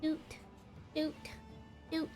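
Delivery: ordinary talk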